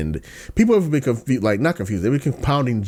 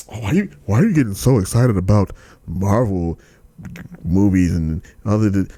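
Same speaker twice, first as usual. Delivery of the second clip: mocking critics. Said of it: "people like".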